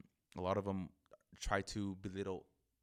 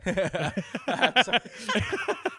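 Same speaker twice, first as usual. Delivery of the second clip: loud laughter; 13 semitones higher, 13 dB louder than usual. Real, not acted. Laughs at host's joke.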